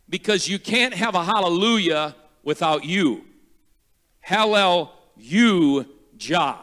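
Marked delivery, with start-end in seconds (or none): none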